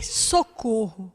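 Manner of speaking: soft, slow, drawn-out call for help; retold cry, hushed. Preacher retelling the crash to the church, a hushed, slow plea. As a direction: let the cry fall to a quiet, slow plea. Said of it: "Eu só gritei".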